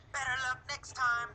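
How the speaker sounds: high, quick acted taunt; mock yell. Teacher quotes Alan's taunt in a question, mock yell. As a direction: pitch it up like a yell to a rival. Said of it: "did Alan yell".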